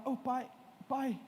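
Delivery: faint, drowsy calling out to dad; barely voicing "pai" as if just waking; weak sleepy call. Son waking up calls his dad faintly, drowsy. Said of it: "assim mesmo".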